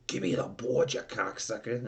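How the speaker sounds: bossy demand in an imitated New York accent. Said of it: "like, in this New York accent".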